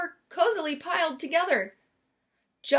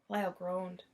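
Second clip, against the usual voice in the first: ordinary delivery, nothing marked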